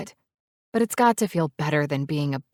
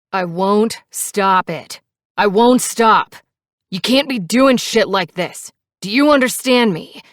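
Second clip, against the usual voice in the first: loud, higher, forceful rebuke; furious; 4 semitones higher, 7 dB louder than usual. A narrator's reading. Furious biker rebuking his passenger, shouting. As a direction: hammer it home angrily, voice raised.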